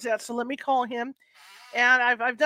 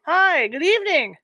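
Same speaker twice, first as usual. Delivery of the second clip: loud phone greeting; 6 semitones higher, 10 dB louder, slower than usual. Real, not acted.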